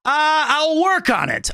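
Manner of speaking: high, slow put-on voice; impression attempt. Shown as a demonstration. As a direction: try the voice again slowly. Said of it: "I need more practice".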